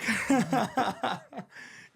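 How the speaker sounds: high rising real laugh. Real, not acted. Laughing at the irony of the film title. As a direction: burst out laughing.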